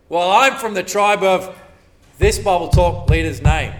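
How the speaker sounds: loud mock brag; mock pride. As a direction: show off your leader's name. Voicing a proud church member.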